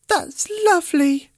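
high, bright-pitched little doll voices; sweet tiny dolls praising. A narrator's reading. Dolls thanking Teddy for cleaning, in high doll voices. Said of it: "said the Doll's House dolls".

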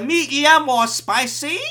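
Chinese waiter. Retold or imitated. Chinese guy taking Shane's order, Chinese accent. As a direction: slowly ask it in a Chinese accent. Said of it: "the Chinese guy was like".

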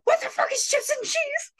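very high, squealed mock bewilderment; baffled outburst. Friend imitating the baffled boyfriend, high-pitched hammed-up outburst. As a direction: exaggerate total disbelief at the order.

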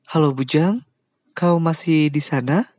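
checking if he's still there; Aunt Kim asking. Acted. Aunt Kim checking the silent line, puzzled. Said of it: "Bibi Kim bertanya".

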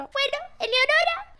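high, timid little voice; shy fan voice. Retold or imitated. Teen fan giving her name to Pimpinela, high and timid. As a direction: say your name like a nervous teen.

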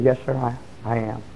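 very low and barely audible; hushed, halting confession. Retold or imitated. Young man owns up to the old preacher, very quiet and humbled. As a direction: admit it haltingly, almost under your breath.

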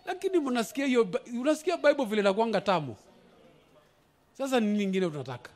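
quiet aside. Real, not acted.

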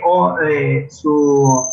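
usual speaking voice

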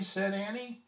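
plain reading voice

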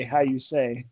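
put-on French accent. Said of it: "they always have to say".

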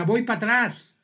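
a touch of Mexican-American border Spanish; border Spanish accent. Retold or imitated. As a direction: pocho accent voiced lightly. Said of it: "tienen un acento especial".

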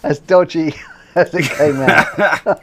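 laughing through the words. Real, not acted. Teasing the guest about his movies, laughing. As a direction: giggle while teasing him about the films.

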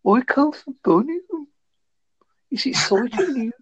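put-on Brummie with a high lilt; exaggerated singsong Brummie; Birmingham accent. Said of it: "I can do a Birmingham".

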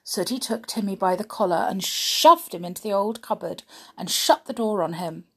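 ordinary delivery

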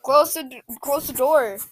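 high, loud panicked yell; panicked shout. Pretend. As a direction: yell at grandma to stop. Kid pleading with scolding grandma.

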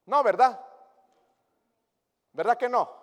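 loud, high rhetorical question; calling out. Real, not acted. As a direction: fling the question at the congregation. Preacher calls out to congregation. (pregunta en voz alta y aguda)

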